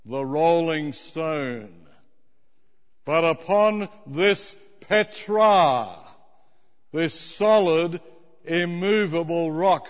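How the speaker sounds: slow, emphatic pulpit delivery; preaching. Acted. Preacher booming scripture to the congregation, slow and emphatic. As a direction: preach every word like scripture.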